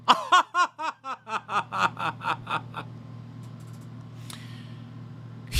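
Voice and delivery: high, drawn-out snooty laugh; hammed-up posh chuckle; fake rich laugh. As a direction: laugh like a pretend millionaire. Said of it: "I'm working on my rich laugh".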